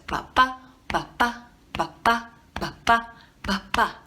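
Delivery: "ba, ba" pairs with the second "ba" stressed; iambic ba-ba beat. Shown as a demonstration. Teacher showing the rhythm in syllables, second ba stressed. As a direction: beat it out like a heartbeat. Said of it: "show you by going like this".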